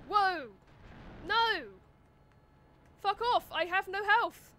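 loud alarmed outburst; panicked yelling. Real, not acted. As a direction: yell in sudden panic. Sudden attack, health gone.

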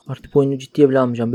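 usual speaking voice

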